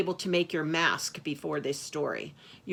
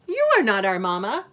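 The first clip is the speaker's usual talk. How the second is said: put-on loud, quick, higher child voice; defiant kid goat; 5 semitones higher, 10 dB louder, faster than usual. A narrator's reading. Sister Kid rejects the wolf, loud defiant child voice. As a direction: object loudly as a young kid.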